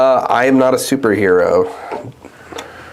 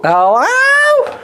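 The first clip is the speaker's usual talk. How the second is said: high squeak. Pretend. Mic check.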